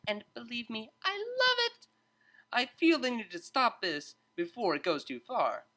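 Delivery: high, bright-toned teacher breaking up a fight; a lively tutor stepping in to calm two students; cheery tutor voice. A narrator's reading. Tutor calming arguing students, high cheerful voice.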